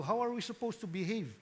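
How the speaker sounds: ordinary talk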